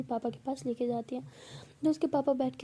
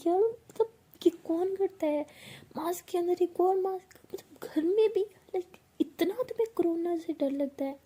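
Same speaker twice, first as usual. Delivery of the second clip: high, disbelieving outburst; can't believe she's wearing a mask at home; incredulous exclaiming; 6 semitones higher than usual. Acted. Bhavna scolds Pihu about the mask, high and incredulous.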